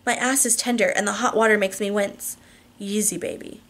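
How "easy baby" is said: gentle warning. A narrator's reading. Husband warns wife in hot water, softly. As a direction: warn tenderly, well under normal volume.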